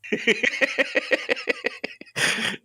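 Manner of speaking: shrieking high laugh; helpless high-pitched laughter. Real, not acted. Laughing at co-host's frustration.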